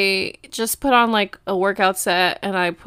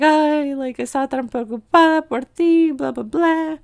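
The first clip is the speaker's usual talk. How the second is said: high, louder mom voice; imitated happy mom; 6 semitones higher, 3 dB louder than usual. Mom greets her daughter home safe, imitated happy fussing. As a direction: mimic a mom fussing in Spanish. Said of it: "my mom was so happy to see me".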